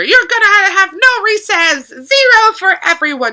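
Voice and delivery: very high, loud mock yelling; imitating angry teacher. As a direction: play a teacher punishing the whole class. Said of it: "they are quite angry".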